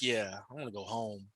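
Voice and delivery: low, soft imitation of a tired girl. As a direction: mumble it low like a worn-out teenager. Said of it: "she's, like, tired".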